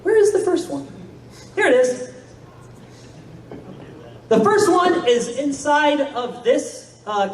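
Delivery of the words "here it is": normal talking voice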